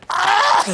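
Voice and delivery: very high, loud imitated shriek; mock scream. Retelling housemate screaming at the cake, loud mock shriek. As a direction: scream like she's losing it. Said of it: "And she went".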